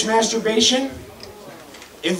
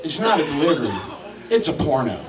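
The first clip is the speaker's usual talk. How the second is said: inner voice; 7 semitones lower, 3 dB quieter than usual. Retold or imitated. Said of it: "a little voice in my head goes".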